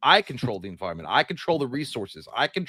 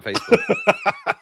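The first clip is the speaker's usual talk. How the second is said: laughing squeak; 5 dB louder, much slower than usual. Real, not acted. Joking about MySpace.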